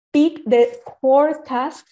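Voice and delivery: usual speaking voice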